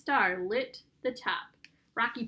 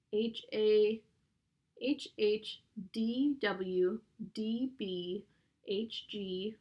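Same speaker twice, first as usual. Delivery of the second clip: each letter drawn out on its own; slow spelling out; much slower than usual. A narrator's reading. Boy tries letter patterns on a puzzle, slow spelling out. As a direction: spell slowly, pausing between letters.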